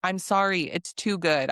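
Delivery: ordinary talk, nothing marked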